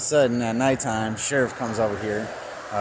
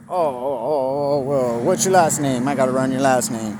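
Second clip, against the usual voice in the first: louder, higher officer cutting in; imitated insistent cop; 4 semitones higher, 6 dB louder than usual. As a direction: retell the cop suddenly demanding details. Cop suddenly demands the last name.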